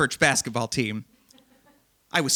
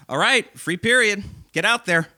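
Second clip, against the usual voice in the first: higher, louder coach dismissing the class; coach calling out; 7 semitones higher, 5 dB louder than usual. Retold or imitated. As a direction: bark out free period to the team. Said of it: "Coach Taylor turns to us and goes".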